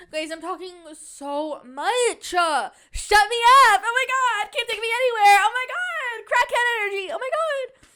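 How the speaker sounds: fizzing with crackhead energy; hyper excited. Acted. Vlogger mocking her own rambling, manic and high.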